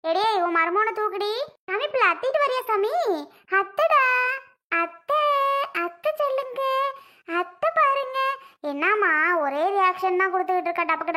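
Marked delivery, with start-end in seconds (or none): 1.7-8.2 high, sing-song cooing; baby talk. Acted. Aunt coaxing baby nephew to look at her, cooing. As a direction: coax a baby in little high phrases.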